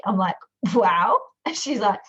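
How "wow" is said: excited wow. Retold or imitated. Reacting to client's news.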